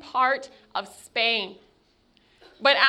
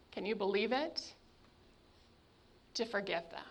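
softly incredulous; quiet amazed aside; hushed aside; 5 semitones lower, 12 dB quieter, faster than usual. Real, not acted. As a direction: drop to a near whisper in disbelief.

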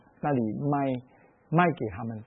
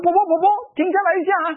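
calling out; 12 semitones higher, much faster than usual. Retold or imitated. Young man chasing the grandmother, calling out urgently. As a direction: shout after her down the street. Running after the old woman.

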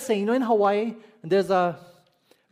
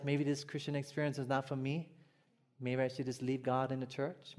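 much softer, defeated inner voice; discouraged murmur; 5 semitones lower, 10 dB quieter than usual. Retold or imitated. Discouraged church member giving up on faith, soft murmur. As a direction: let the doubt slip out quietly.

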